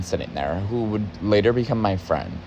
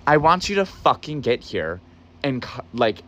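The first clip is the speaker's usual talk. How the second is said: exasperated rant; 6 semitones higher than usual. Retold or imitated. Ranting back at lazy boss.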